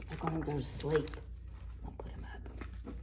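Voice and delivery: low quick aside; soft quick comment; quiet aside. Real, not acted. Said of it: "quiet aside to children".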